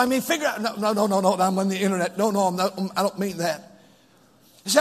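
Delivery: ordinary talk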